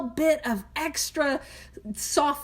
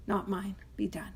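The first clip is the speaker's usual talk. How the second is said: whispered prayer ending; 4 semitones lower, 10 dB quieter than usual. Shown as a demonstration. Ending the demo prayer for Steve, in a whisper. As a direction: breathe the end of the prayer in a whisper. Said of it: "and this is how I do it".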